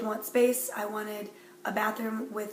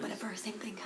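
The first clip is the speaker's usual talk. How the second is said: whispered; 9 dB quieter than usual. Real, not acted. Dismissive aside after being corrected.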